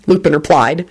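usual speaking voice

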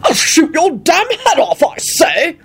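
high, blustering threat; bellowing. A narrator's reading. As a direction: bluster like an outraged, unrepentant father. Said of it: "Richard bellowed out unrepentantly".

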